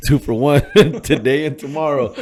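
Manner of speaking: high, loud words broken by real laughter; laughing while talking. Real, not acted. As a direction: crack up mid-sentence. Cracks up telling the story.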